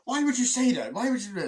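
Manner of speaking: high, loud mock indignation; hammed-up squabbling protest; bickering. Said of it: "the bickering that the two of them have".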